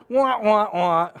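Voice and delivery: mock sad-trombone sound after a corny joke; sad trombone wah-wah. Preacher capping his own corny joke, mock trombone wah. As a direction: voice a comic womp-womp sting.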